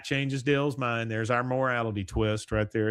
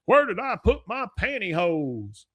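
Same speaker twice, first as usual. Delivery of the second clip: high mock voice; 8 semitones higher, 3 dB louder than usual. After-credits quote.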